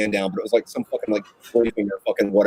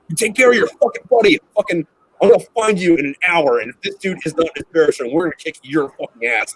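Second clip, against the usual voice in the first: angry threat; 6 semitones higher, 4 dB louder than usual. Retold or imitated. Sergeant threatening a junior Marine.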